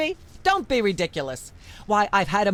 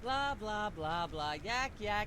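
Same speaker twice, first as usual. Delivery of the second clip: background chatter; 14 dB quieter than usual. Acted. Myra drones on beneath talk.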